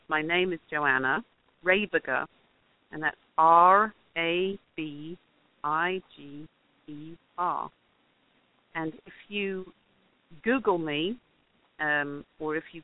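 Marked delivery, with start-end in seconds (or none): 0.1-7.7 really slow, letters set apart by pauses; measured, deliberate name and spelling. Shown as a demonstration. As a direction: spell it carefully for listeners to write down. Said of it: "say it really slowly and spell it".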